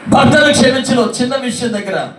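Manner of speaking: loud, rising sermon exhortation; preacher pleading with listeners to forgive; full-force preaching. Acted. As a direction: drive the point home to the church.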